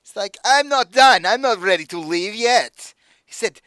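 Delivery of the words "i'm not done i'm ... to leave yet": much higher and louder, strained put-on accent; accented villain voice. Patron refusing to leave the booth, loud strained accent. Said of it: "Doing this voice is gonna kill me".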